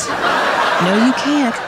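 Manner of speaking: breathy laughing no; amused aside laughing. Real, not acted.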